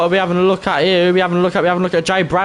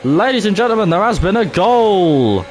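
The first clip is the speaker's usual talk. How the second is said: goal announcer; 5 semitones higher, 3 dB louder than usual. Acted. Streamer announcing a goal to viewers, football announcer. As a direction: announce a goal to the crowd, stretching the last word.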